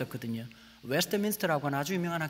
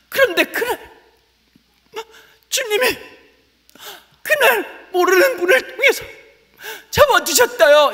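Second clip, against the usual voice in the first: shouting a borrowed testimony in full preacher mode; mock testimony preaching; 13 semitones higher, 14 dB louder than usual. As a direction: ham up a fiery testimony climax. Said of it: "이런 얘기를 너무 많이 들었던 거예요".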